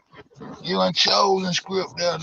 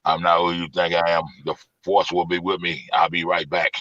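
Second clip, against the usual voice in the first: deep, lower mock Terminator voice, imitating; Arnold Schwarzenegger; 10 semitones lower than usual. Mocking a caller by putting on a low Schwarzenegger voice. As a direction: ham up a movie tough guy, low.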